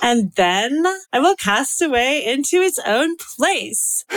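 put-on Valley girl accent ending like a question; Valley girl uptalk. Shown as a demonstration. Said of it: "do everything with a question at the end".

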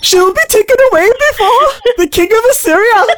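put-on botched Irish accent. Sister reading scripture in an accent, botched Irish through laughter. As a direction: read through giggles in a wobbly Irish put-on. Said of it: "You going fucking Irish".

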